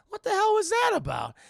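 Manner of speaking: high, loud bewildered question; squawked, baffled reaction; incredulous outburst. Acted. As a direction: exclaim in mock disbelief. Bystander bewildered by fight.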